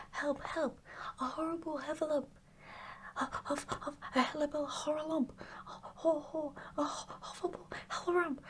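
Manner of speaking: high, rushed, tongue-tangled panic; panicked crying out. A narrator's reading. Piglet scampers off from the heffalump, crying out in panic. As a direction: stammer and jumble it in high-pitched fright. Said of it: "still crying out".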